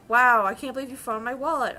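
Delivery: loud, bright gushing thanks; Wow, loud and delighted, mock gushing; gushing customer. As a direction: play the thrilled customer overdoing it. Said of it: "be like".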